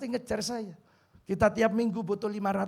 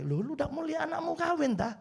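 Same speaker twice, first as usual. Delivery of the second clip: wife teasing; 10 semitones higher than usual. Retold or imitated. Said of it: "Kata istri saya".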